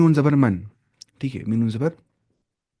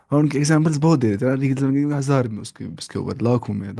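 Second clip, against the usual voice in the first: ordinary talk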